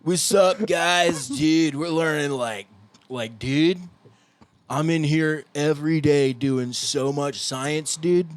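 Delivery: slow, stretched-out mock drunk. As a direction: drag every word like a stoned drunk. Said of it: "That's the drunk voice".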